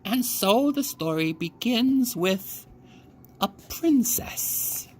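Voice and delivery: put-on high, slow, hushed old storyteller; slow storytelling old woman. A narrator's reading. Grandmother opens the bedtime tale to the girl, slow and hushed. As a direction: draw it out like a spell.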